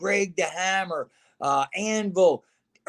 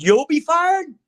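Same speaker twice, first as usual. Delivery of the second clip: much higher and louder, disbelieving question; incredulous echo; 10 semitones higher, 5 dB louder, slower than usual. Retold or imitated. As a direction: fling the words back in disbelief.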